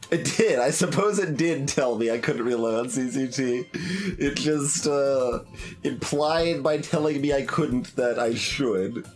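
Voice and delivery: normal talking voice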